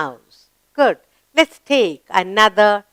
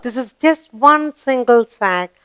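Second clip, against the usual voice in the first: usual speaking voice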